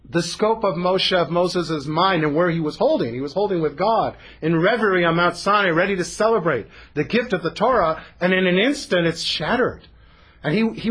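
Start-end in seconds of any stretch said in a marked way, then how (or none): none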